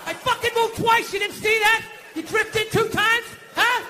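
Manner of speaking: loud, high New York street yell; De Niro tough guy. Retold or imitated. To the woman who bumped him, a loud De Niro street yell. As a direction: bark it like a cabbie in traffic. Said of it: "old school Dustin Hoffman, De Niro".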